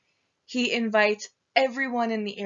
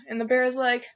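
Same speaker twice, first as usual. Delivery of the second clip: usual speaking voice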